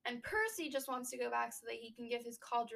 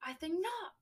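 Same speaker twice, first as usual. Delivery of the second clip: put-on higher-pitched British-accented catchphrase; mock British accent; 7 semitones higher, 8 dB louder, slower than usual. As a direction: say it like a dramatic Brit. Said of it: "you said it like a British accent".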